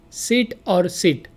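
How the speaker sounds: sit and sit drawn out slowly, contrasting short and long vowel; slow minimal pair. Shown as a demonstration. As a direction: stretch sit, then sit. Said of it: "many words sound similar".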